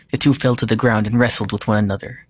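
usual speaking voice

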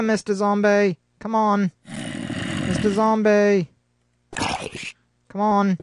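high-pitched sing-out to lure someone over; coaxing call. Pretend. Calling out to lure a zombie.

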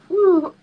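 high, loud "whew"; high exhaled whew; overwhelmed whew. Real, not acted. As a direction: let the emotion out in a high whoop of relief. Recovering from being overwhelmed by emotion.

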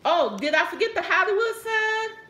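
ordinary talk

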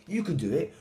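lightly voiced mouse cheering him on; encouraging mouse. A narrator's reading.

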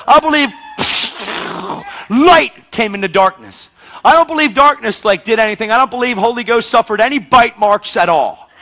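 loud, high, driving sermon; preaching at full force. Acted. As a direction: preach it out to the whole room. Preacher builds on Jesus descending.

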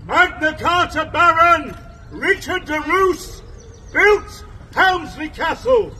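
ordinary talk, nothing marked

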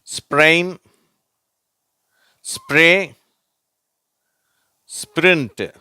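ஸ்ப்ரெயின், ஸ்ப்ரே, ஸ்பிரிண்ட் said high and loud; teacher reading spr words for the class; model words sprain, spray, sprint. Shown as a demonstration. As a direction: read the spr words out for repetition. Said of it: "வார்த்தைகளை படிக்கிறேன், நீங்களும் படிங்க".

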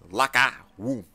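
"Locker room" read out low, word by word; reading the sign. Acted. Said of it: "I know how to read".

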